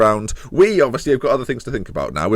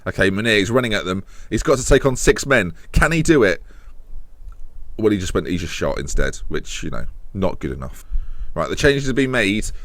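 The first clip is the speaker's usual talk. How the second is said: ordinary talk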